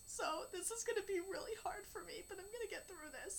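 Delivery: real grief, voice wavering on the edge of crying; holding back tears. Real, not acted. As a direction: voice catching, close to crying. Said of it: "I'm probably gonna cry right now".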